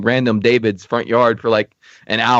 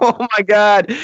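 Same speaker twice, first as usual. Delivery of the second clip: higher, exasperated exclamation; exasperated oh my God; 9 semitones higher, slower than usual. Retold or imitated. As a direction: throw up your hands in disbelief. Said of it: "I was like".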